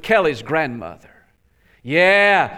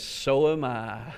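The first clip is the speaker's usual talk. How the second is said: slow, low, soft reply; understated missionary; 4 semitones lower, 7 dB quieter, slower than usual. Acted. Missionary answering visitor who is just visiting, slow quiet reply. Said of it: "And the missionary says".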